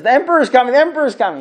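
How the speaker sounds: high, loud, rushed excited announcing; all excited. Retold or imitated. Apostate eagerly announcing the emperor to Rav Yosef, high loud excitement. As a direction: blurt it out like the big moment is here. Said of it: "and the guy gets all excited".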